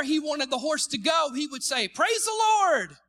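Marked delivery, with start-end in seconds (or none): 1.9-3.0 loud, high, drawn-out praise shout; acted revival-style shout; exclaimed command. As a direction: call it out like a command to a horse. Acted horse command in a joke.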